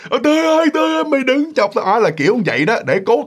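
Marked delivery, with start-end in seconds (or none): 0.1-1.9 high-pitched imitated plea to the ghost; mock frightened wail, pitched way up; calling out loudly. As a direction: cry out so someone far off hears too. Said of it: "kiểu như nói lớn vậy đó".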